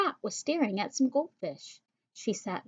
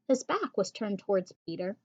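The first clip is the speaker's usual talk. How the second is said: ordinary delivery, nothing marked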